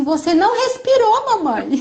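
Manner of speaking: mock little boy catching mum out; imitating her son. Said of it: "Ele falou assim".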